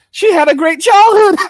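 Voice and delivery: high, loud incredulous exclamation; loud outburst. Real, not acted. Telling viewers she had a great childhood, loud incredulous outburst.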